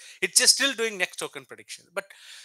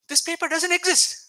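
amused disbelief; 11 semitones higher, 5 dB louder than usual. Real, not acted. Lecturer exposes fake AI citation.

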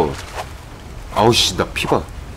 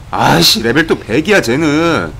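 annoyed outburst; 4 semitones higher, 7 dB louder, slower than usual. Real, not acted. Annoyed at high-level creature.

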